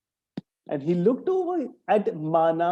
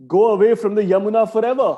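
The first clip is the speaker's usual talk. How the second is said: stern and deep; 4 semitones higher, 8 dB louder, much faster than usual. Acted. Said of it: "in a stern and deep voice".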